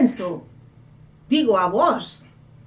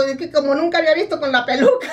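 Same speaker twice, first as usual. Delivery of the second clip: laughing; 5 semitones higher, 3 dB louder, faster than usual. Real, not acted. Laughing explaining the cat.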